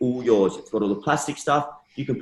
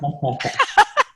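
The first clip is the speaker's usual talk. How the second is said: breathy high laugh; 3 dB louder than usual. Real, not acted. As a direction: burst out laughing. Burst of laughter.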